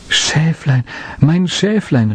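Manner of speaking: high glad cry of relief; joyful call. A narrator's reading. Boy finding his lamb, calling joyfully. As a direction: call out overjoyed. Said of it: "rief er freudig".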